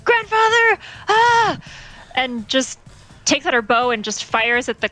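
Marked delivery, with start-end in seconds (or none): 0.0-1.6 high, alarmed cry; alarmed yell. Acted. Relna crying out as her grandfather is attacked. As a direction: cry out as he's nearly hit.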